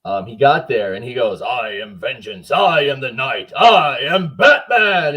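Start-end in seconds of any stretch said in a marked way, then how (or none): 1.4-5.2 Batman voice. Retold or imitated. Fan retelling how the actor greeted the line in Batman's voice. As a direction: growl out the famous Batman lines for the crowd. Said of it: "in his voice".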